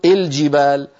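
الجبال begun with "i", "il-jibaal"; wrong opening kasra. Shown as a demonstration. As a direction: say الجبال as "il-jibaal", first vowel i. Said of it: "ما نقول".

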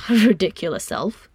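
amused scolding through a laugh; chuckling, mock-insulting. A narrator's reading. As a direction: laugh into the words. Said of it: "then he began to laugh".

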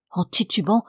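ordinary delivery, nothing marked

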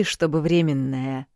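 временная slowed and stressed. Acted. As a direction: lean hard on временная. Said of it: "выделил он голосом". (с нажимом на «временная»)

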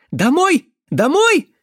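very high, loud simpleton cries; shouting boy. Acted. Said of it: "закричал Венька". (высокий крик дурачка)

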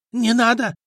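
high, desperate begging cry; frightened plea. A narrator's reading. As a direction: beg for mercy, voice shooting up. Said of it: "начал извиваться ростовщик".

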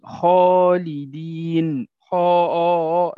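slow, loud خالدين with the alif drawn out full and heavy; heavy alif after kha. Shown as a demonstration. Teacher demonstrating to students a heavy alif, slow and loud. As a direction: stretch the آ of خالدين, dark and heavy like the خ. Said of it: "هذه الألف المادية فخمت بسبب الخاء".

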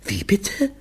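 high, unbelieving question; appalled. A narrator's reading. Mother hearing her children's confession, high and aghast. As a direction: ask it aghast, pitched right up. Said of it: "blieb vor Entsetzen der Mund offen stehen".

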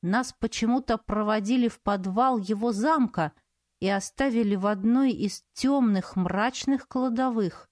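ordinary delivery

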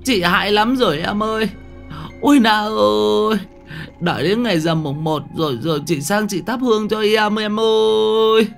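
a terrified woman begging her dead neighbour's ghost; terrified stammering plea. A narrator's reading. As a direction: babble and beg the ghost to leave. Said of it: "Bà lắp bắp".

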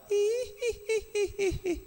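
high, slow eerie giggle like a ghost. Pretend. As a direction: giggle like a spooky film ghost. Preacher imitates ghost giggle. (cekikikan hantu melengking pelan)

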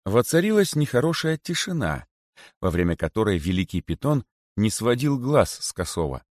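ordinary delivery, nothing marked

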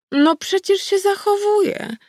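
high, slow and playful goddess voice; old wolf goddess. A narrator's reading. Goddess insists to her granddaughter she behaves, high and teasing.

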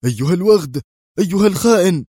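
loud, high cries of outrage climbing; screaming. A narrator's reading. Commander runs for the exit, screaming at the traitor. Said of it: "يصرخ".

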